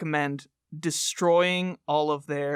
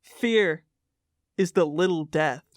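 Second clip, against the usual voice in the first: dramatic poster recitation. Acted. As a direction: intone each phrase like a cult creed. Reads poster litany aloud.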